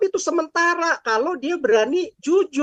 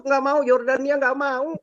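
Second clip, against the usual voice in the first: ordinary delivery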